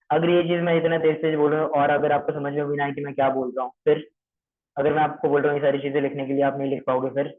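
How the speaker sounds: fast, words tumbling out; rushed fast talk. Shown as a demonstration. Trainer shows callers how rushing sounds, fast. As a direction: rattle it off too quickly to follow. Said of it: "तो ये हो गया तेज".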